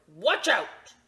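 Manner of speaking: put-on loud, sharp old man snap; grumpy. Grumpy old man snapping at the little boy, loud. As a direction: snap it at the kid. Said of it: "that is grumpy".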